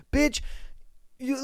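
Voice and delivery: ordinary talk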